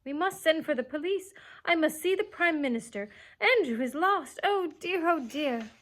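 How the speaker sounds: high, loud distraught lady calling out; frantic Miss Lark calling. A narrator's reading. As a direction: panic for a lost pet. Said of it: "waving her arms and calling".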